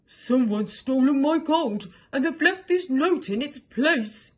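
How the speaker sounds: distressed dragon wailing loudly; upset cry. Acted. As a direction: cry out that the gold is gone. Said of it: "he cried".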